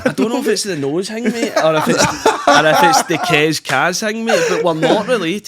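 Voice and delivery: high and loud, laughing through the denial. Real, not acted. Denying the cousin rumour to the host, laughing. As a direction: chuckle the words out as you deny it.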